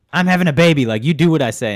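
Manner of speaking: loud, high bossy wife voice; hammed-up bossy wife laying down the law; mock demanding wife. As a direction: boss him around as the pregnant wife. Wife voiced bossing husband.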